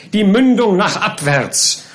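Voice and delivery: high, slow, emphatic order; insistent command. A narrator's reading. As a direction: order it firmly, stretching the words. Pickwick orders the gun lowered.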